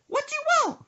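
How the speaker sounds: put-on very high, loud old-lady squawk; caricatured grandma in a piercing falsetto. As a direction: holler back like an irritated grandma. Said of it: "She said".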